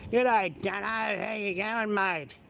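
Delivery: lazy Australian mate-to-mate hello; lazy Australian slang. Shown as a demonstration. Showing viewers how Australians talk, lazy Aussie slang. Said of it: "It's called lazy Australian slang".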